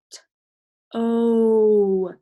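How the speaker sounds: "T" as a bare sound, then a long loud "O". Shown as a demonstration. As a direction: separate "T" and hold "O" steady. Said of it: "Now I've got the sound O".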